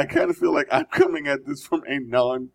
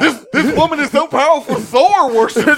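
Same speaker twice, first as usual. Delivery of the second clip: excited proclamation; 10 semitones higher, 6 dB louder than usual. Acted. Hammed-up proclamation.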